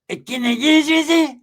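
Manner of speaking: slow drawn-out homeboy voice. Retold or imitated. Cousin Pepe voiced.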